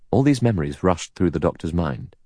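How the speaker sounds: ordinary delivery, nothing marked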